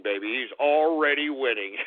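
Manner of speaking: ordinary talk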